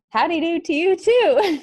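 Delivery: high, loud mock-excited reply. Tired aide answering the doctor, loud and fake-excited. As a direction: blurt it out with forced cheer. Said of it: "I said it very loud, too".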